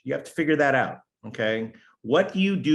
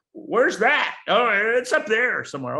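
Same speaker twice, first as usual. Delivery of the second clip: higher and a touch louder, lightly mocking; clueless American; 10 semitones higher, 3 dB louder than usual. Mocking ignorant Americans who ask where Canada is, higher. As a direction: play the dumb American asking where Canada is. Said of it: "us ignorant Americans".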